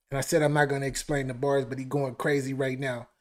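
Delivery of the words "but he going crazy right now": ordinary delivery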